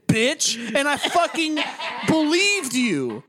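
hammed-up exasperation, stretching the key words; exasperated retort. Answering back to the movie's love interest, exasperated.

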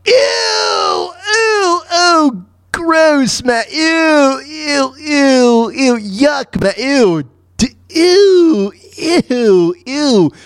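high, drawn-out cries of disgust; long squealing ews, piled one on another; grossed out. Acted. Disgusted reaction to crude joke.

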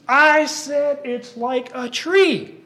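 high, loud and slow indignant yell; all up in the air. Acted. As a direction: puppet Daniel blowing his top, insisting he's right. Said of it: "he gets all up in the air about this".

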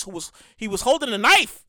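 ordinary talk, nothing marked

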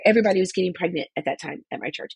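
ordinary delivery

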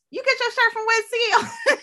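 high-pitched, laughing quote; high retold exclamation.

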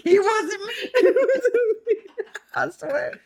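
very high, long-drawn squeal of laughter; laughing denial. Real, not acted. Friend denying it to the group, squealing with laughter. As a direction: squeal the denial, barely getting it out.

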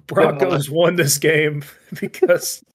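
slow, laughing through the words. Real, not acted. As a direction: chuckle as you say it. Laughs while reading the result.

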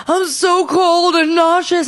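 high, slow mock whine; whiny complaint. Sick woman waking up to her partner, whining. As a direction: draw the misery out in a high whine.

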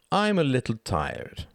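put-on high, slow boy's drawl; slow, high-pitched boyish excuse; feigned tiredness. A narrator's reading. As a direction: plead tiredness with lazy insolence. Boy refuses, feigning tiredness.

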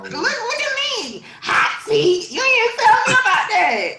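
high, loud squeal of surprise; laughing shriek of disbelief; playful outraged surprise. Real, not acted. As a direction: burst out, shrill with mock indignation. Reacting to surprise project announcement.

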